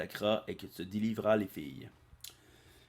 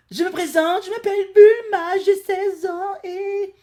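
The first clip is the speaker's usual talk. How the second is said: put-on very high, loud falsetto girl; mock girly voice; 15 dB louder than usual. Goku introducing himself as a girl, in a high loud falsetto. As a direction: squeak it like a cartoon schoolgirl.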